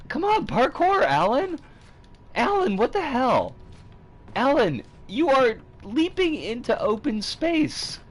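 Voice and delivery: loud, high exasperated shouting; yelling at screen. Real, not acted. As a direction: yell at the runaway character. Yelling at character Alan.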